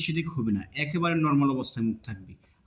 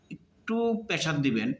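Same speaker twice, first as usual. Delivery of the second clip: usual speaking voice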